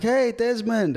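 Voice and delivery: high, bright-pitched mock hello; called-out greeting. Retold greeting called out in elevator.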